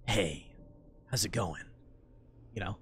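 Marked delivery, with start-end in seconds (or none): 0.1-1.6 low, soft dream-man voice; sexy dream voice. Acted. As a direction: play a hunky night visitor, deep and hushed. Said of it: "like, sexy, like, dream voice".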